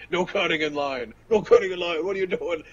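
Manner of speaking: high-pitched, playful telling-off; playfully police the queue; mock scolding. Players in line scold queue jumpers, mock indignant.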